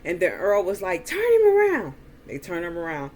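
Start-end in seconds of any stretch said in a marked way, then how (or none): 1.1-1.9 loud, high-pitched yelled command; barked order. Retold or imitated. Uncle ordering men holding the beaten man, barking. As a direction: bark it out like the man in charge. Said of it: "Earl just started barking orders".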